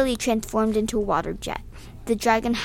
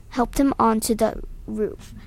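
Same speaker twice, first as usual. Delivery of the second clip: usual speaking voice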